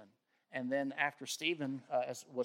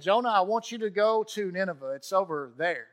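God commanding; 4 semitones higher, 8 dB louder than usual. Acted. God's command voiced in story.

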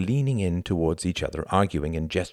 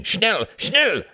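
very high urgent shouts; shouting; 5 dB louder than usual. A narrator's reading. Pilots shouting across the airfield, urgent shouting. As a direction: shout it sharply to hurry them up.

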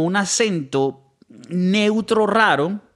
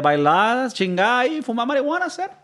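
put-on Cuban accent, rising in pitch; 7 semitones higher than usual. Podcaster mimicking Caribbean party-lovers, mock Cuban accent. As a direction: stretch it upward like a partying Cuban. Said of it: "lo dije en ese acento".

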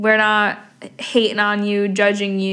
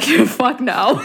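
emphatic retort; 3 semitones higher than usual. Retold or imitated. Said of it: "And I'm like".

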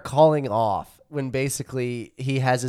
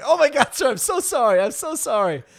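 much higher, laughing, flustered grovelling voice; mock panicked apology; 11 semitones higher than usual. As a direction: play a principal frantically backpedalling.

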